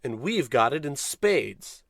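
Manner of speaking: normal talking voice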